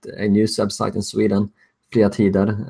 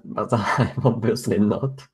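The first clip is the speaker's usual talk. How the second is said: laughing; 4 dB quieter, slower than usual. Real, not acted. Laughing at the doxxers' mistake.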